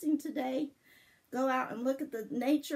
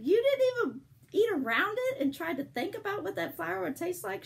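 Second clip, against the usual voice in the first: amazed question; 8 semitones higher, 5 dB louder than usual. Retold or imitated. Incredulous question to Sandy.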